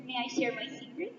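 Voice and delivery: hushed, fast conspiratorial aside; confiding secret. Acted. Speaker confides a secret to the audience, hushed. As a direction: lean in and share it softly and quickly. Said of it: "a secret".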